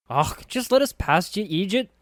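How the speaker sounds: high, loud scornful snap; sharp snap. A narrator's reading. Anna snapping at the thug Bish, high and loud. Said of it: "Anna snapped".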